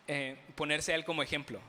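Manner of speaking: normal talking voice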